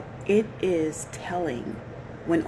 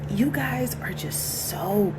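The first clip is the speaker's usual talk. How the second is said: whispering; 5 semitones higher, slower than usual. Real, not acted. Scolding viewers in a whisper.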